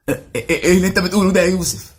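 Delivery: fast, high, outraged squeak; shocked high-pitched partner. Retold or imitated. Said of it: "قاموا بأدوار اليهود في المسلسلات".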